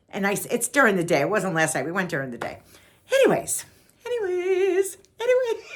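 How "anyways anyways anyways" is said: very high singsong transition; high little singsong voice; playful high-pitched repeats. Real, not acted. Playful repeats to resume story.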